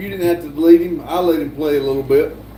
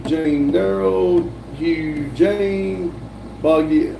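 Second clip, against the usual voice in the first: ordinary delivery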